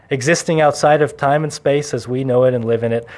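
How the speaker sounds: ordinary talk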